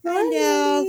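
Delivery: very high pet-greeting voice; dog talk. Real, not acted. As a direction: coo hello to a dog.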